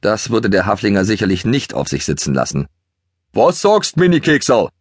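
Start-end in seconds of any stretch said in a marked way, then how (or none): 3.3-4.7 higher, mocking Bavarian jab; spiteful Bavarian. A narrator's reading. Toni mocks Keks back, spiteful and Bavarian, higher. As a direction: needle the little pony in Bavarian. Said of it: "gehässig von Toni zurück".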